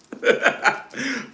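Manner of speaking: short high giggle; high laugh. Real, not acted. Teasing Barry, high giggle. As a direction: giggle again.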